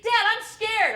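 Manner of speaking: put-on high, loud scared little girl; scared child. Sister begging Dad from the back seat, scared. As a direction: cry out to Dad, frightened. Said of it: "She says".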